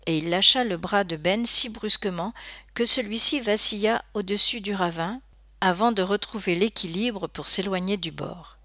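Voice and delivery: ordinary delivery, nothing marked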